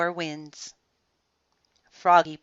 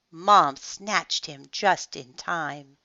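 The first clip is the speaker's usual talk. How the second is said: ordinary delivery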